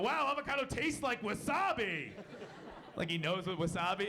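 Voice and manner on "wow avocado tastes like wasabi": high-pitched imitation of a diner exclaiming; mock yell. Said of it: "if he yelled out".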